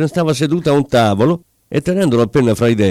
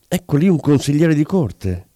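plain reading voice

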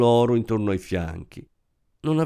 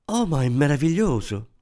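exclaiming; 5 semitones higher than usual. A narrator's reading. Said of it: "esclamò la donna".

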